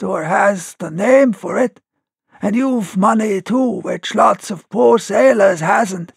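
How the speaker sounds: a strained voice shaking like a taut rope; hoarse shaking sailor. Acted. Tom refusing Silver, hoarse and trembling. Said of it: "spoke as hoarse as a crow".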